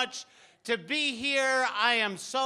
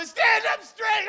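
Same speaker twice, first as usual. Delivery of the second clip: high, loud, slow shouted command; barked order; 9 semitones higher, 7 dB louder, slower than usual. Retold or imitated. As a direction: bark it like a drill-sergeant dad. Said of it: "Then he'd go".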